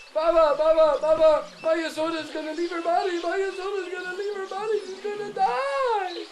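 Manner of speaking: panicked cry. Acted. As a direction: burst in crying out the bad news. Servant runs in with alarm.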